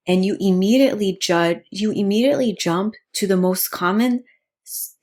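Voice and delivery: ordinary talk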